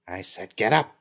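loud harsh order; angry command. A narrator's reading. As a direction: bark the order again. Risa orders the prince.

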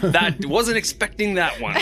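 slow, high, laughing through it; can't stop laughing while talking. Real, not acted. Laughs at the near shooting.

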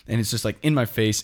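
normal talking voice

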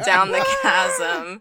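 laughing. Real, not acted. Marco's scream fades down chasm.

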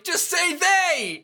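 slow, very high mock yell; hammed-up exasperated cry, each word stretched; Ephemer. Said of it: "And then Ephemer's like".

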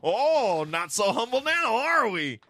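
high, loud gloating jab; mock taunt. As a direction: crow it at him teasingly. Mocking his misuse of humble.

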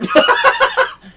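high-pitched burst of laughter; high squeal laugh. Real, not acted. Laughs at short summary.

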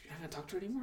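hushed whisper behind her back. Retold or imitated. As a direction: whisper it so she can't hear.

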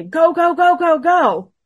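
high, loud urging in an imitated panic; urgent shout. Becky, last one down the stairs, urging the group on, urgent shout. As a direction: act out hurrying everyone along, loud and high.

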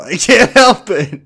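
mock-helpless, slow and loud. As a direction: stretch it out and push the word help. Repeats apology, second take emphasized.

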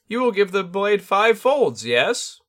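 high, loud bird spirit sensei; a lofty bird teacher, amused; Tengu voice. Acted. Tengu gives instruction.

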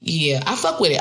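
ordinary delivery

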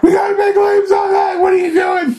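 high, panicked yelling; freaking out. Retold or imitated. Young insurance salesman panics at monster damage, yelling high and loud. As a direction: shriek it like a salesman losing his mind. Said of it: "is freaking out".

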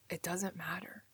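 breathy hushed whisper. Real, not acted. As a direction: let it fall to a whisper. Heavy conclusion about life.

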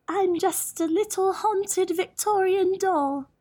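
high, slow haunted doll voice; a calm, eerie little Victorian doll; too calm creepy doll. Acted. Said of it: "The doll said".

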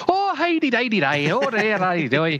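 put-on high, singsong stage-Irish accent; mock Irish accent. Friend mimicking the actor's put-on brogue, exaggerated Irish accent. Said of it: "how well this accent i-, is done".

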